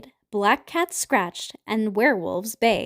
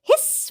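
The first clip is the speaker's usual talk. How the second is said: high, sharp hiss; vampire hiss. A narrator's reading. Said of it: "went the six".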